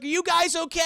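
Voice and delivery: high, loud shout to friends; hammed-up anxious check-in; mock worried call. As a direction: call down as a concerned rescuer. Eddie calling down to stranded friends.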